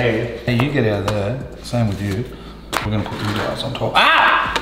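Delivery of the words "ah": sharp high yelp of pain. Real, not acted. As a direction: yelp as the heat hits. Burned by the hot pan.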